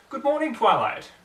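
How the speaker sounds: high, loud cheerful hello; cheerful Rarity. Acted. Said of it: "she said cheerfully".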